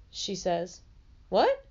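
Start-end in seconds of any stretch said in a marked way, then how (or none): 1.3-1.6 surprised. Acted. Ben hears surprising news.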